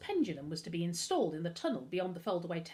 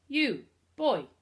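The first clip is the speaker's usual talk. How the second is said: put-on loud, higher call with a French accent; 5 semitones higher, 8 dB louder than usual. A narrator's reading. Butler catches boy climbing the gate, French accent. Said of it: "It was a French accent".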